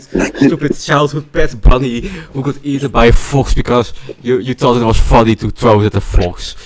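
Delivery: loud teasing jab; loud mocking roast. Real, not acted. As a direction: roast him at full volume.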